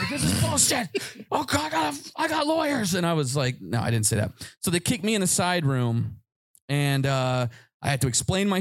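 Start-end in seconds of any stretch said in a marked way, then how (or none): 0.1-2.9 high whiny protest, far above his own voice; mock entitled whine. Detained teen whining to store security, high mock entitlement. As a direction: play the spoiled brat who threatens lawyers.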